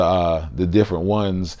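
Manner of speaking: normal talking voice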